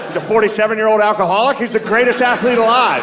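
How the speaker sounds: high, hammed-up radio sportscaster; old-time announcer. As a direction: parody a vintage play-by-play man. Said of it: "You can hear the announcers".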